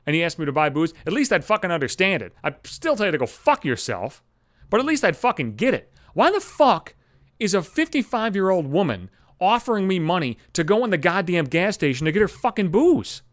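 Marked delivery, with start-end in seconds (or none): none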